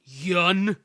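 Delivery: loud, bold boast; mock-heroic taunt. Player taunting Yun with cheat codes, loud mock-heroic taunt. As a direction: call out your foe with swagger. Taunts boss before the fight.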